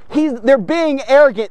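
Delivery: usual speaking voice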